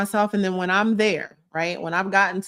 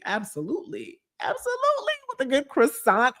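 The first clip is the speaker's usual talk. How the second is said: much higher, squealed yes; excited; 7 semitones higher than usual. Real, not acted. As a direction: squeal it with delight. Excited agreement with chat.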